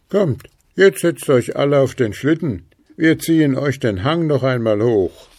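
ghosts inviting. A narrator's reading. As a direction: call everyone onto the sledge. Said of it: "boten die beiden Gespenster an".

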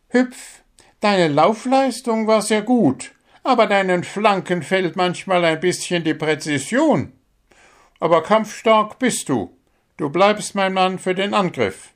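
a bird coach praising and correcting a player; small bird coach. Acted. Coach bird giving the squirrel feedback.

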